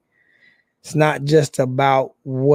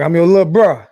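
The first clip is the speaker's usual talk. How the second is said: playful loud greeting; 4 semitones higher, 9 dB louder, faster than usual. Retold or imitated. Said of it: "I was like".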